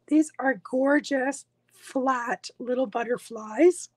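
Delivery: ordinary delivery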